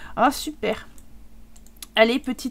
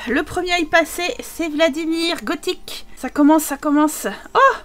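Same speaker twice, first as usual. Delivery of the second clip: normal talking voice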